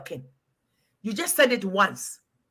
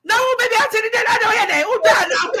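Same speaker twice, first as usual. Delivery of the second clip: laughing shout; 12 semitones higher, 6 dB louder, much faster than usual. Retold or imitated. Retold shocked reply, laughing.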